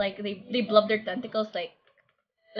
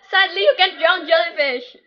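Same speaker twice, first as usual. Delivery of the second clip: laughing; 7 semitones higher, 4 dB louder than usual. Real, not acted. Laughing at a chat message.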